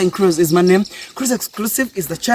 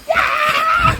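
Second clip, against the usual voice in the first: joyful shout. Real, not acted. Cheering at the view.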